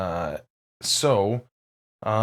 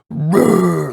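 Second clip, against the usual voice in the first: huge fiery dragon roar; 6 semitones higher, 9 dB louder than usual. Shown as a demonstration. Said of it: "like, I can't do it".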